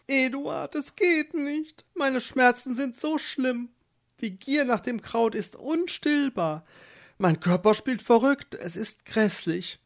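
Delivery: put-on craving, desperate young wife; suffering wife. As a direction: beg him through the cramps. Craving wife begs her husband.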